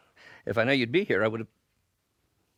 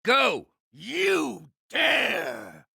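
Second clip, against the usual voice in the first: raised, indignant villain cry; outraged outburst; 6 semitones higher, much slower than usual. Acted. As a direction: flare up at his nerve. Villain barks at opponent.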